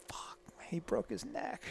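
slow, hushed dread; very soft, aghast whisper; horrified whisper. Retold or imitated. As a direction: whisper it as the worst sinks in. Retold dread at friend's injury.

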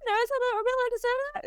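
high, fast startled mock alarm; shocked question. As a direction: blurt it out, high and quick, half-scandalised. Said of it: "And I was like".

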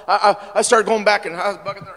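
ordinary delivery

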